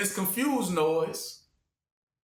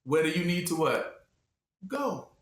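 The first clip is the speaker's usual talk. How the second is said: ordinary delivery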